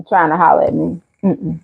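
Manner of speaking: usual speaking voice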